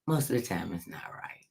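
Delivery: unvoiced confiding whisper. Real, not acted. Whispered aside to viewers about gut feeling. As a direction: lean in and whisper it.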